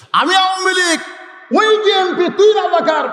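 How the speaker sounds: a party man shouting the others into line. Acted. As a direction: bellow it down at the others like a bossy leader. Awami League figure scolding others.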